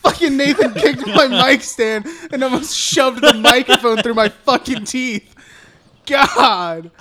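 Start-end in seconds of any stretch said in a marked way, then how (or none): 0.0-5.3 loud, laughing complaint; laughing outburst. Real, not acted. Podcaster blames Nathan for kicking the mic stand, laughing outburst. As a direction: rant loudly through your laughter.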